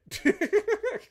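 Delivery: a burst of high laughter; quick gleeful cackle; short high laugh. Real, not acted. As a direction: laugh at your own threat. Laughing at own war threat.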